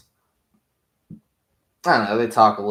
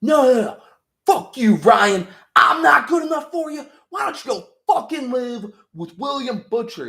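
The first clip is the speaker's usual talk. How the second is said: very high, loud hysterical yell; hammed-up screaming fit with a bitter laugh at the end; mock screaming tantrum; 7 dB louder than usual. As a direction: scream at the son like a jealous father. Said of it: "He's like".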